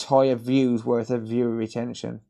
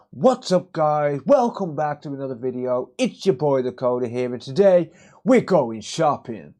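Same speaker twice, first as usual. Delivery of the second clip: hyped YouTuber intro; 2 semitones higher, 4 dB louder than usual. Pretend. A car boot vlogger greets viewers in a loud, hyped intro voice. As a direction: pump it up like a cheesy YouTuber. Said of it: "Is that okay if I talk like that?".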